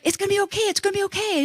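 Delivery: high, soft reassurance; soothing flight attendant. Retold or imitated. Attendant calms panicking passenger, high soft soothing. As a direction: coo it softly, voice lifted. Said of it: "She's like".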